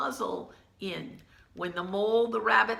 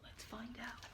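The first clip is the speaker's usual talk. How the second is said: whisper; 21 dB quieter than usual. Real, not acted.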